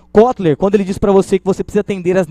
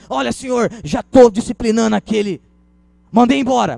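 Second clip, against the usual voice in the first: high, loud show of discipline; hammed-up salesman's indignation; feigned severity; 7 semitones higher, 4 dB louder than usual. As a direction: announce it forcefully to impress the customer. Salesperson feigns outrage for customer.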